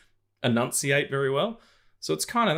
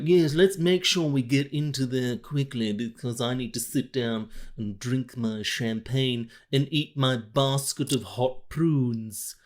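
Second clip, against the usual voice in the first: slow, chin-jutted posh British drawl with a Brando mumble; posh Marlon Brando; 3 semitones higher, slower than usual. Pretend. Mocking the posh ballet crowd in a slow Brando-posh drawl. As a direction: speak like you have money in your mouth, slowly. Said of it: "I was doing this stupid voice".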